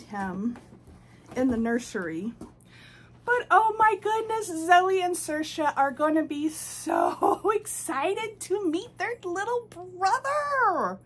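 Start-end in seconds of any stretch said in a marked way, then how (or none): 3.2-11.0 high, drawn-out excited gush; squealy doting excitement. Real, not acted. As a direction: gush with delight, stretching the words. Gushing over new doll's arrival.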